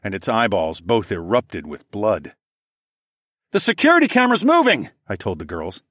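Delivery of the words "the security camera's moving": high, loud alarmed call; raised voice, quick and high; urgent warning. Acted. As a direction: call out a warning, pitched right up. Warns the group during attack.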